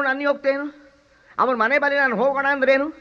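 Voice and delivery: ordinary delivery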